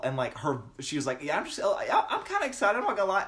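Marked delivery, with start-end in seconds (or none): 1.2-3.2 imitating mom's giddy Southern drawl; high, laughing put-on Southern accent; excited mom slipping into Southern. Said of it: "he's got a bit of Southern drawl".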